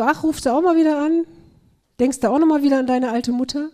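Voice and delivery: a hint of a sulky, needling mother; mock reproachful mother. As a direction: imitate a mother nagging her child.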